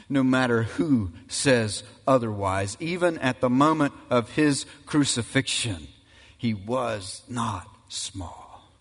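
normal talking voice

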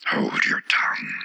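low, slow, whispery rebuke; Tiger Lily crying out. A narrator's reading. The Tiger Lily silences the Violet, a low rebuke. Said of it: "cried the Tiger Lily".

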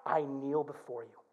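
soft, low surrender to the king; hushed humble prayer. Acted. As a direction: drop low and soft, kneeling.

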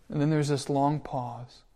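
normal talking voice

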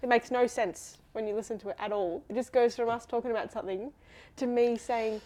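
usual speaking voice

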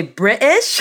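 drawn-out put-on British pronunciation; British accent. Shown as a demonstration. Guest defending how she says it, mock British accent. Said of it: "likes to put on this horrible accent".

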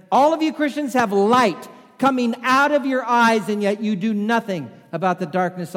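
high, loud accusing yell; shouting in frustrated fury; angry shout. Retold or imitated. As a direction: hurl the accusation at them. Paul repeats his accusation.

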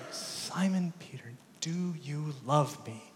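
soft, unvoiced, slow whisper; whispered question. Jesus asks a third time.

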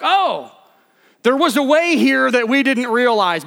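high, loud mock amazement; amazed realization. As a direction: act out a sudden discovery. Israelites amazed at parted waters.

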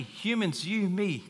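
ordinary talk

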